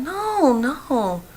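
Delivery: high, swooping retold protest; protesting denial. Storyteller answering his accusation, protesting denial. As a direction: pitch soars on the first no, then eases down.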